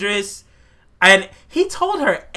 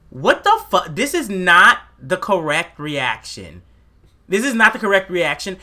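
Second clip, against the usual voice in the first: normal talking voice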